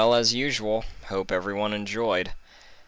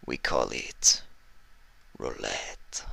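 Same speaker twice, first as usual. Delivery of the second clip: put-on low, whispered northern-accented thug; menacing northern heavy; 6 semitones lower, 6 dB quieter, slower than usual. Iceberg promising cruelty to the captives, low whispered menace. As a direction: purr it, low and sinister.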